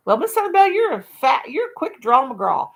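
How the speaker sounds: high, loud, sassy Southern belle. Acted. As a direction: tease Miss Annabelle in a prissy high lady voice.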